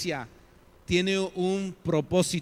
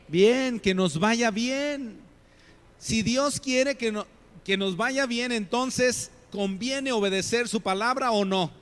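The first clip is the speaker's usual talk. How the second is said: higher, louder, slow on "que nos vaya bien"; emphatic preaching; 6 semitones higher, 3 dB louder, slower than usual. Acted. Preacher insisting God wants good for them, raised and emphatic. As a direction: drive the point home from the pulpit.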